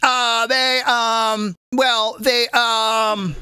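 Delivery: hesitant spokeswoman full of drawn-out fillers; Sarah Huckabee. Retold or imitated. Host mocking a press secretary's answer, halting impression. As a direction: stall on drawn-out, level ums. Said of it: "that's how she talks".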